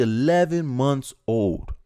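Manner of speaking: slow, stunned repeat; stunned disbelief. Real, not acted. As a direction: say it slowly, as if it won't sink in. Stunned by the children's age.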